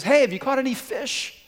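high-pitched call across the water. Retold or imitated. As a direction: call out a question to people far off. Man calls from shore to fishermen.

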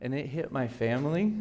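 hoarse, under the weather; sick voice. Real, not acted. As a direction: talk through a bad cold. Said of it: "if you hear me sound like I'm dying up here".

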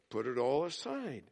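just shrug it off; dry answer to the whiner; deadpan reply. Pretend. [name]'s flat deadpan reply.

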